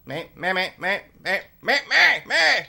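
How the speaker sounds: high squawking alien chatter; Mars Attacks alien. Retold or imitated. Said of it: "reminds me of the aliens from Mars Attacks".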